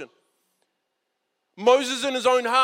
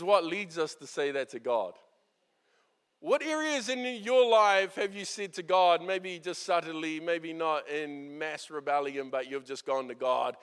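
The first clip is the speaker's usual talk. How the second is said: ordinary talk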